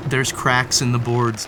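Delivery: usual speaking voice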